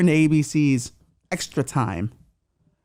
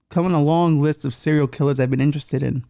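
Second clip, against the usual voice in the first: usual speaking voice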